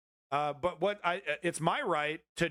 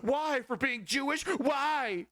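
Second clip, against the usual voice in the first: mock indignant whine; 11 semitones higher, slower than usual. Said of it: "mocking the man's reply".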